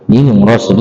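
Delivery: ordinary delivery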